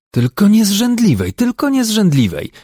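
high, loud protest in character; mock wife indignantly protesting. A narrator's reading. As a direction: object shrilly, fully in the wife role. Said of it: "zaprotestował Zaworek, który mocno wczuł się w rolę".

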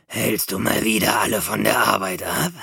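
mürrischer alter Mäusekönig; grumpy old mouse king. A narrator's reading. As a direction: grumble at the idler from above. Mouse king scolds Mopsa.

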